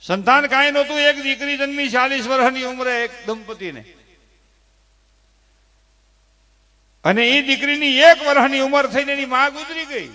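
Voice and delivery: loud, high, swelling sermon cry; impassioned preaching. Acted. Preacher telling listeners why daughters' farewells bring tears, impassioned. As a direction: lift it like a preacher moving the crowd.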